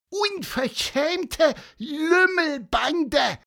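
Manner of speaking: very high, slow squawking scold; high squawky bird yelling at rowdy men; bird shouting. Acted. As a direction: screech it out as a cross little bird. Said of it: "rief Pieper, so laut er konnte".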